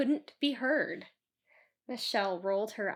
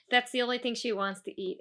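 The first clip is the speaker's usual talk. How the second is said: usual speaking voice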